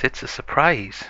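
Mommy Pig voice. Acted. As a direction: tease the surprise as the mother pig. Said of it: "Mommy Pig goes off and says".

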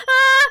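high, loud shriek; startled scream. Real, not acted. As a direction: scream in sudden fright. Scare in the car.

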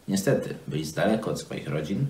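usual speaking voice

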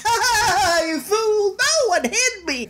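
cartoon villain taunting; defiant thief. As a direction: jeer back at the monkey. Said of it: "It's like".

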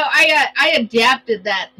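normal talking voice

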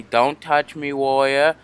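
ordinary talk

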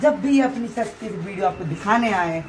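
ordinary talk

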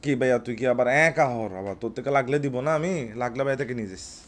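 usual speaking voice

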